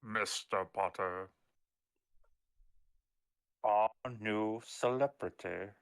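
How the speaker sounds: low, slow, soft delivery; slow movie villain. Acted. Opening quote to Mister Potter, slow menacing impression. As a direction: draw the words out with quiet disdain.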